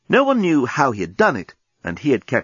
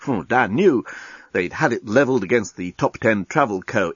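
usual speaking voice

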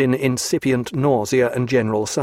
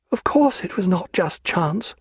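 higher, weary woman's voice; a sick woman speaking from her bed; Diana's voice; 6 semitones higher than usual. A narrator's reading.

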